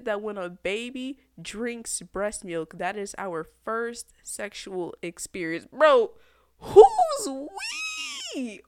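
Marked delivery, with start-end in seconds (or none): 5.7-8.6 very high, loud yell; outraged shout. Real, not acted. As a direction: scream the question in disbelief. Ranting at Freud again.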